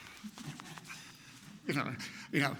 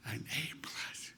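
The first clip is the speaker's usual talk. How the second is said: slow, hushed revelation; awed whisper; 12 dB quieter, slower than usual. Retold or imitated. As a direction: whisper it slowly in wonder. Discovers the grade on the paper.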